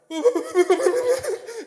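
loud high-pitched mock bawl; squealing fake sob; mock sobbing. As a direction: wail like an overwhelmed fan, hammed up. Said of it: "Is he crying?".

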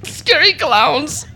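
slow words through laughter; laughing at own punchline. Real, not acted.

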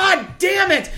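high, sharp cry of pain; pained yell. Retold or imitated. Dad slaps his fresh tattoo, high pained yell. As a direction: yelp as the fresh tattoo is slapped. Tattooed arm grabbed, yelling.

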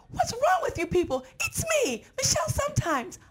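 high, grand diva proclaiming. Retold or imitated. High, grand self-adoring diva scolding her Facebook fans. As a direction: play the beloved star, outraged at the fans. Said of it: "And I'm like".

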